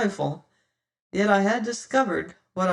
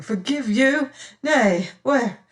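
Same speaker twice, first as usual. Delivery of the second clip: higher flustered repeat of "Forgive you"; stammering; 6 semitones higher, 4 dB louder, slower than usual. A narrator's reading. Martin flustered holding his trembling companion, stammering. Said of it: "I fell a stammering".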